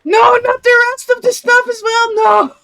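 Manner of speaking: high despairing yell; panicked shout. Real, not acted. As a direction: yell as everything burns. Stuff burning, panicked shout.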